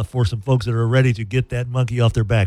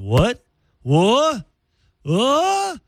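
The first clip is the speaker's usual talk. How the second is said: mock excited kids, climbing higher each time; rising "What?" like thrilled kids; much higher imitated kids going wild; kids' excited reaction; 9 semitones higher, much slower than usual. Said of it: "excited with every single".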